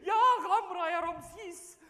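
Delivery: high, loud, slow mock wail of panic. Caught by a robber at gunpoint, hammed-up panicked wail. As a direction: howl it like a hammed-up lament.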